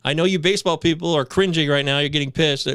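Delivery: normal talking voice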